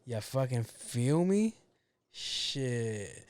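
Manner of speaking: normal talking voice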